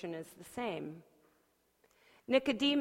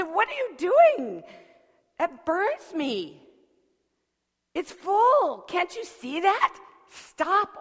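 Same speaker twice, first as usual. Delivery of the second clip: high, loud, furious cry; angry shout; 10 semitones higher, 9 dB louder, slower than usual. Acted. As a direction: yell it, hand burning. Tea scalds her hand.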